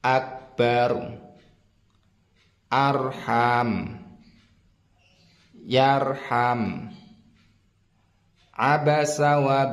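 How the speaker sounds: slow, measured recital with a thick r; model Quran recitation. Shown as a demonstration. Teacher reads the examples to learners, slow tajwid recitation. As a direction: draw out the long vowels and land on a heavy r. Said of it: "saya akan membacakannya".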